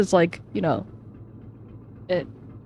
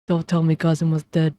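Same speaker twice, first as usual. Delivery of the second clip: ordinary talk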